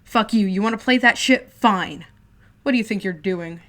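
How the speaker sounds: loud furious yelling; boy yelling as he packs to leave; angry shouting. Acted. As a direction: yell while storming off. Shouting while packing.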